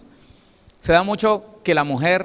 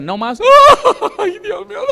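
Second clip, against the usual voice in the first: hammed-up wailing laugh-cry, much higher and louder; preacher parodying an abandoned wife crying out, much higher and louder; mock wail; 10 dB louder than usual. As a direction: burst into a mock wail of despair. Wife wailing after husband leaves.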